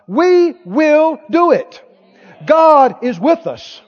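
slow, emphatic declaration; deliberate, resolute, pitch lifted; faith talking. Acted. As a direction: hammer each word with conviction. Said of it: "Is that how faith talks?".

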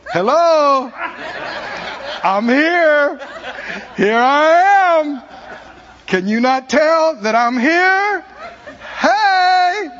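loud, high, laughing call; mock exasperated God shouting back; voicing God. God voiced as answering.

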